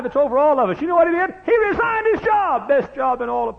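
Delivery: high, loud hollered sermon lines; preaching at full force. Acted. Preacher hollering to the church about Matthew. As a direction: preach it out to the congregation.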